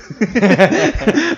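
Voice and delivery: short high laugh; bright burst of laughter; amused laugh. Real, not acted. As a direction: laugh out loud at the memory. Laughing at own story.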